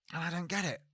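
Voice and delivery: ordinary talk, nothing marked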